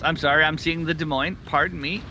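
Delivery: ordinary talk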